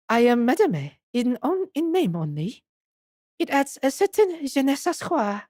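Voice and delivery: put-on French accent; Esmeralda Tuspels. Madame explains her title to her visitors, French accent. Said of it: "Okay, it's French".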